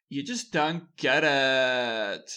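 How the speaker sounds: drawn-out, whiny-style mock quote with "get" stretched long; mock dismissive boss. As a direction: drag it out like a boss fed up with everyone. Quoting dismissive boss in mock complaint.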